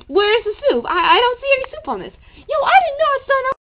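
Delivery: high, fast flustered complaining; panicked whine. Acted.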